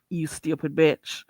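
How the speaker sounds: ordinary talk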